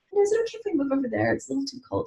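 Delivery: soft, high, fast polite asking; polite request. Retold or imitated. To her ex, softly asking to move tables, sweet and light. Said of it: "I'm just like".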